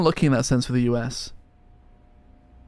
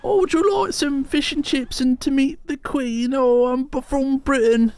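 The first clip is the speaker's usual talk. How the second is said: high, slow, hammed-up British accent; a silly tourist-brochure British accent, much higher; mock British accent; 11 semitones higher, much slower than usual. Offering fish and chips in a high mock British accent. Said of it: "Can you do a British accent?".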